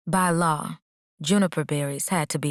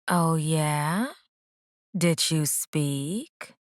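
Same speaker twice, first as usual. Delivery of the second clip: sing-song teasing; much slower than usual. A narrator's reading. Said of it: "What's with the sing-song voice".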